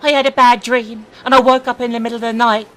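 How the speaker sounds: put-on a touch of a scared kid telling it; hint of a young boy's voice; boy's voice, lightly. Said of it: "says the boy".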